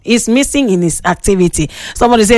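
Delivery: ordinary talk, nothing marked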